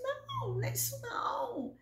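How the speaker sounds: very high, soft protest; pleading denial. Retold or imitated. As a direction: push back in a thin high register. Answering her imagined critics.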